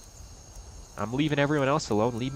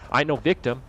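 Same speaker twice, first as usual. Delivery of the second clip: normal talking voice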